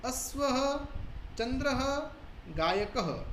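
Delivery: teacher's model reading for the class; visarga recitation. Shown as a demonstration. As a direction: list each word ending in a breathy "-aha". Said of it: "विसर्ग की ध्वनि अह ऐसे निकलेगी".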